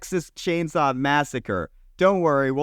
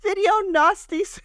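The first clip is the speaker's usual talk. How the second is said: Oh, Delali in a hammed-up British accent, pitched way up. Asked by cohost to do British accent on air, hammy British. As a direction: sing out Oh, Delali like a cartoon Brit, high and stretched. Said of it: "Say video nasties in a British accent".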